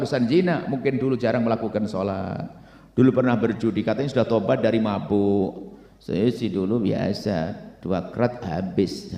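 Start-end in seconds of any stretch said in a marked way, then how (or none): 6.0-9.1 low, slow mock bragging; boastful ex-drinker. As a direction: brag lazily in a low, stretched voice. Reformed drinker bragging about past.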